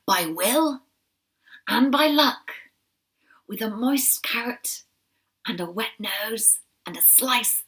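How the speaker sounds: a voiced old rabbit, dropping to whispers in places; wise old soldier. Battle-scarred uncle counsels Shiloh, whispering and proclaiming.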